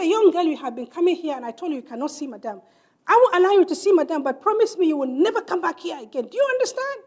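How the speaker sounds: loud, high-pitched angry scolding; scolding guard. Retold or imitated. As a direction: bark at the girl like an upset guard. Angry security man scolding.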